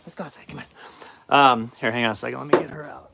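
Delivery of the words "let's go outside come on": soft whisper to the dog. Real, not acted. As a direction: breathe it to the dog.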